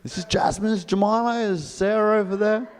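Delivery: high, bright-pitched introductions of each chicken; eager sanctuary lady. Retold or imitated. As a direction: gush like a proud hen mother. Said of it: "Starts introducing me".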